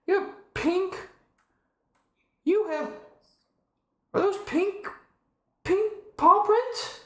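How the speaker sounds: very high, slow cooing; drawn-out squeaky wonder at tiny paws. Real, not acted. Cooing over kitten's paws.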